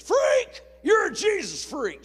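high, loud, slow jeering yell; mocking bully shout. Schoolgirl bully yelling at the Bible reader, loud jeer. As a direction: sneer it across the gym at him. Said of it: "She sounded just like that".

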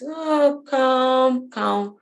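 high, held, chant-like calm; mock serene Jesus. Mocking how people paint Jesus, exaggerated serene calm. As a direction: draw out each word like a holy hum. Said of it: "always painting Jesus as this".

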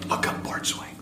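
whispered aside to a colleague. Retold or imitated. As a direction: hush it so the caller can't hear. Whispering to partner at desk.

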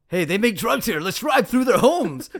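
lightly hammed-up reckless cop, delighted with his bad idea; callous action heroes. Mocking the Bad Boys Two heroes to his co-host, gleeful and callous.

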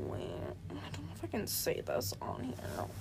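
soft unvoiced aside; hushed whisper. Real, not acted. To the listener, unsure if she can say this: hushed whisper. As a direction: whisper it, unsure if it's allowed.

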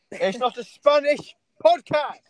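loud, slow, exasperated scolding. Real, not acted. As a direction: spell it out, fed up. Scolding Max for speaking Spanish.

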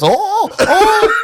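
high, loud laughing mock shock; shocked lady. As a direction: yelp like you just spotted the mess. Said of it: "She goes".

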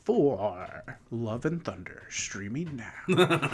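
stretched-out promo voice; hammed-up movie voice-over; mock trailer announcer. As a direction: announce it like a streaming ad. Parodying a film trailer voice-over.

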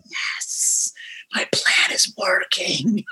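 whispered mock-villain gloat; gleeful scheming. Host gloats that his friend caught the earworm, whispered glee. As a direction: draw it out in a hushed, scheming whisper.

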